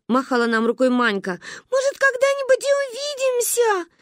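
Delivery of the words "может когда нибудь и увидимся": put-on high-pitched plaintive child; melodramatic farewell. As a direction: lament like a departing little martyr. (жалобно, тонким голосом)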